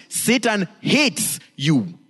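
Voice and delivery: slow, hates leaned on hard; preacher warns the church slowly; stress on hates. Real, not acted. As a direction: drag out Satan hates you. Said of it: "slow emphatic line".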